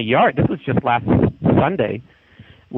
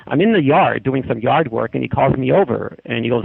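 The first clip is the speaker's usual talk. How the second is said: normal talking voice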